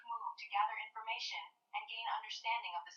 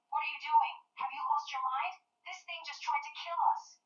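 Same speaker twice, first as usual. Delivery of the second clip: higher, louder bewildered outcry; exclaiming; 7 semitones higher, 4 dB louder than usual. Acted. Dazed Percy protests the demon's release, loud bewildered outcry. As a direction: cry out, dazed and alarmed. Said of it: "he exclaims".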